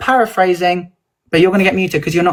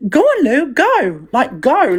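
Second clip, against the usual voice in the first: higher, pushy urging; 6 semitones higher, slower than usual. Retold or imitated. Retelling how she urged Lou, higher pushy urging. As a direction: hurry him along, pitch up.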